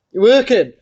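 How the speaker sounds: high, loud mock boss order; firm boss. [name] telling him he's working, high firm. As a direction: lay down the law.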